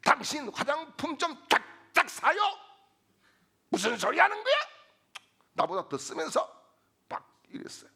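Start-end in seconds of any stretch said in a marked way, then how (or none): 0.0-6.6 husband firing back, high and increasingly loud; mock marital quarrel, indignant and high; imitated indignant retort. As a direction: act out an outraged comeback in a raised voice. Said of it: "맞대응을 하게 되었습니다".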